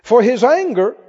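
rising to loud, ringing proclamation; building preached scripture. Acted. Preacher climaxing the psalm before the church, building loud. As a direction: build it up to the morning.

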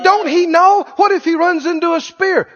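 high-pitched doubting questioner; worried objector. Acted. Somebody doubting the charging horse, worried. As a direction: question it as an anxious onlooker. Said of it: "Somebody said".

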